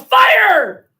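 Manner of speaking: high, very loud shout; drawn-out joke punchline yell; yelled alarm. Acted. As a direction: yell it as a diversion. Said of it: "And the American yells".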